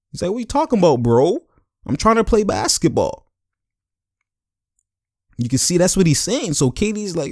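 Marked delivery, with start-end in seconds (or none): 0.3-3.3 high-pitched imitated protest; Iguodala protesting. Iguodala just trying to play basketball, high baffled protest. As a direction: plead bafflement in a raised pitch.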